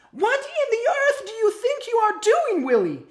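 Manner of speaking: high, loud alarmed scolding; mother's shriek. A narrator's reading. Mother stopping her wormling leaving the hole, shrieking. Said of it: "she shrieked".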